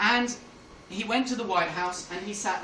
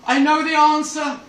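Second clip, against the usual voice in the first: triumphant high shout; exclaiming; 7 semitones higher, 12 dB louder than usual. Acted. Helms tells Bush he has it, exclaiming. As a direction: shout it out, bursting with the news. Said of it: "he exclaimed".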